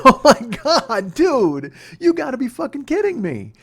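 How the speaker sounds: loud, high, drawn-out incredulity; exasperated disbelief. Retold or imitated. Reacting to Jill's clip about the hug, loud disbelief. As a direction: stretch the opening out loud in disbelief.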